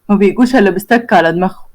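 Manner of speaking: ordinary delivery, nothing marked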